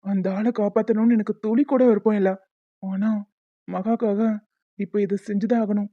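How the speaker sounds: normal talking voice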